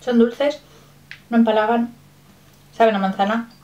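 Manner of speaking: speaking between bites; chewing while talking. Real, not acted. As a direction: mouth partly full. Seen 1: Bites cookie before; lips pressed, chewing motions while speaking, cookie held.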